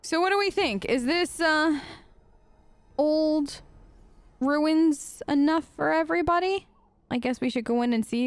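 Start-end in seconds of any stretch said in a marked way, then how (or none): none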